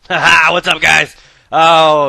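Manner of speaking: high, loud laughing hello; loud greeting. Real, not acted. Streamer greeting his viewers, loud and laughing. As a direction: burst in hyped for the viewers.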